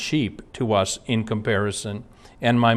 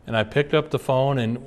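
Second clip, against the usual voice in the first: normal talking voice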